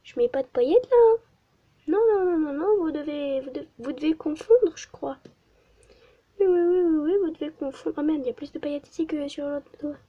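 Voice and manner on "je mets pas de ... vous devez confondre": higher, louder, hammed-up; playful denial. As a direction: deny it theatrically. Mock-denial to viewers. (dénégation faussement outrée)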